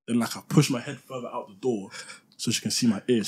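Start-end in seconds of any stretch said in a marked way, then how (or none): none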